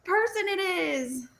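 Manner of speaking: high, drawn-out retold wail; mock despair. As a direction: wail it high in comic distress. Acting out her panicked confusion.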